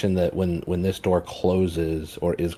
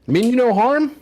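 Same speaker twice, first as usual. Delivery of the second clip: high, loud put-on friendly voice; friendly greeting voice; 5 dB louder, slower than usual. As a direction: greet the giant sweetly, all pitched up. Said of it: "I'm gonna say".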